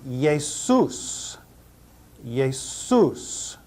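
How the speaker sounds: Jesus said YAY-soos with a y sound; Jesus as Greek Iesous, ye-SOOS; Jesus, y not English j; Greek pronunciation. Shown as a demonstration. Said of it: "This is the word for Jesus".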